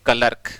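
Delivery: क्लर्क as "ka-lark", with the r sounded; everyday Hindi-style "kalark" for क्लर्क; common mispronunciation. Shown as a demonstration. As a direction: sound the r before the final k in क्लर्क. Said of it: "हम बोलते हैं".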